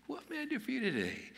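very high, put-on sing-out voice; sweet household offer. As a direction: pitch it far up, offering help at home. Same household words, second take.

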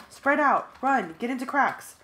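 loud high urgent commands; shouted orders. A narrator's reading. Narrator shouts escape orders to friends, loud and high. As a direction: bark orders to fleeing friends.

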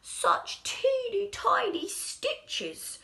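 high, slow breathy wonder; amazed whisper. Acted. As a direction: breathe it in awe. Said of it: "the shoemaker said".

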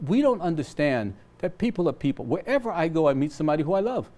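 ordinary talk, nothing marked

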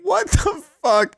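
laughing at the fake prank; burst of laughter. Real, not acted. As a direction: crack up, then disbelief.

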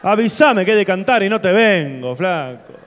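loud indignant rant; queja a los gritos. Real, not acted. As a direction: yell it like you were tricked. Sigue gritando indignado. (reclamo a los gritos)

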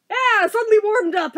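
usual speaking voice

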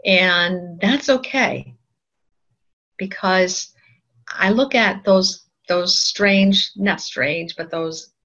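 ordinary talk

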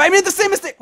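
high, loud cry of dismay; high-pitched frustrated yell; frustrated outburst. Real, not acted. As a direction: shout it in exasperation at yourself. Repeats a mistake in game.

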